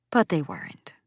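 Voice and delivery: ordinary delivery, nothing marked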